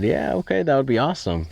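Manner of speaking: high, bright yes to the interview; eager yes. Retold or imitated. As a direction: jump at the chance. Eager reply to newspaper.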